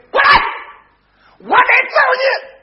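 high, loud, slow menacing yell; threatening shout. Acted. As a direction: bellow the threat at him. Son threatens to beat father. (儿子要打爹，高声威胁)

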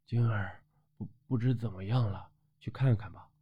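low, very soft murmur; hushed worry. A narrator's reading. Friend checking on Jing'er after the storm, hushed worry. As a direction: keep it down, far below narration level.

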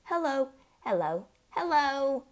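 high parrot hellos. A narrator's reading. Hidden parrot calls to the tense family, high parrot voice. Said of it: "came the voice again".

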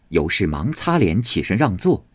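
ordinary delivery

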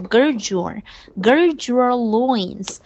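"gird your" slowly joined as "gir-jor"; linked words. Shown as a demonstration. Teacher models linking for the class, slow joined words. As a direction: melt "gird your" into one run, drawn out. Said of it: "Gird和your也可以连读".

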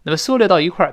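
ordinary talk

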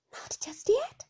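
high, tender little question. A narrator's reading. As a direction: ask gently, pitched high. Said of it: "said my mother tenderly".

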